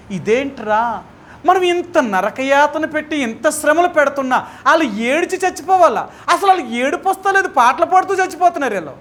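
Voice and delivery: high, rising disbelief; can't believe they die singing; baffled persecutors. Acted. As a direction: torturers astonished the martyrs won't weep.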